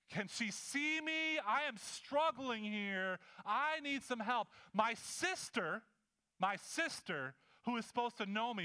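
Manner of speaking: high-pitched, drawn-out exasperated plea; frustrated sister. Acted. Preacher voicing Martha silently fuming at her sister, exasperated. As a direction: stretch the words out in rising indignation. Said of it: "the frustration just rises".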